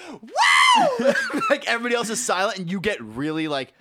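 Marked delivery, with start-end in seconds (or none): 0.1-1.1 high, loud woo; happy whoop. Acted. Older dude answering the instructor in commercial, exuberant whoop. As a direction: whoop gleefully, all alone. Acts out lone rider's whoop.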